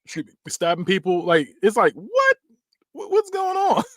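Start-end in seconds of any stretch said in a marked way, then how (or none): 1.9-3.8 high-pitched incredulous squeal; exaggerated shocked outburst, way up high; mock bewilderment. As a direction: squeak it out in disbelief. Acting out baffled onlooker in retelling.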